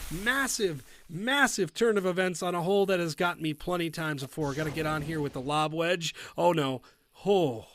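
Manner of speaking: usual speaking voice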